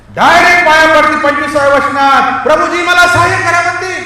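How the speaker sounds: high, loud preacher's voice; full-force preaching. Real, not acted. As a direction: cry out the story with force.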